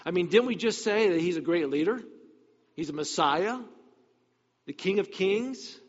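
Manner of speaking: ordinary delivery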